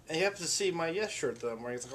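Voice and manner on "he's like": normal talking voice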